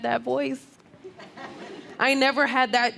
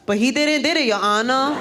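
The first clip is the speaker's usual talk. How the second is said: put-on cutesy Puerto Rican girl accent, higher pitched; mock Puerto Rican accent; 4 semitones higher, 3 dB louder than usual. Lawyer pleading to the judge, cutesy Puerto Rican accent. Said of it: "if you have that kind of accent".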